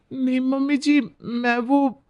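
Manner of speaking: normal talking voice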